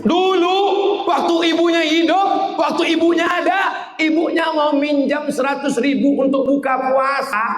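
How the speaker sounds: a preacher shouting out the rebuke; fiery preaching. Real, not acted. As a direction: thunder at the neglectful sons. Preacher rebukes sons, at full force.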